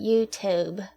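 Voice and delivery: British accent. Shown as a demonstration. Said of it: "say YouTube in a British accent".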